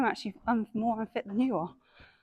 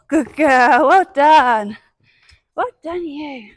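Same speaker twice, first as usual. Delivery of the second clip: gushing praise; 5 semitones higher, 16 dB louder, much slower than usual. Real, not acted. Praising pony after clean poles.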